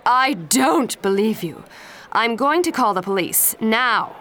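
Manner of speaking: loud angry threat; shouting. Acted. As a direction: shout at the boys to scare them. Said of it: "Aunt Grace shouted".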